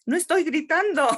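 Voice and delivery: mock loud denial from the mother; loud protest. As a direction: play the mother snapping back at her kids. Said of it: "Ella hablaba fuerte".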